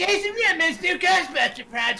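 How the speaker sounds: very high, squeaky reading; messed-up voice. Pretend. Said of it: "My voice is messed up".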